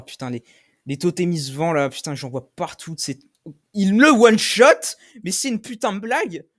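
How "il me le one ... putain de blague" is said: cri aigu et fort, indigné; crier d'énervement. Real, not acted. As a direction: hurler sa frustration contre le jeu. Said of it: "je suis désolé de crier". (crie, furieux contre le monstre)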